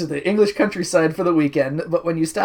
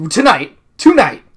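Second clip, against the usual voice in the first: louder, higher, rising excitement; excited; 5 semitones higher, 9 dB louder than usual. Acted. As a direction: repeat it with growing alarm. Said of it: "We leave tonight".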